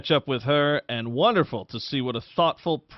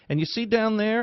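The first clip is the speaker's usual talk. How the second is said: a bright, much higher "you see down there?", still sweet; cheery question; 9 semitones higher than usual. Retold or imitated. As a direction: point down the hall in a light, high, friendly voice. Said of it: "the smile went off her face".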